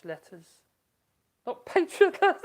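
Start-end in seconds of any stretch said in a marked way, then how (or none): 1.5-2.4 high, louder comic squeak; squeaky punchline; mock squeal. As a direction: pop the joke up high. Jokey quip about French letters.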